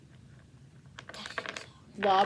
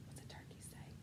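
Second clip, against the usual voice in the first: whispered hint; 27 dB quieter than usual. Real, not acted.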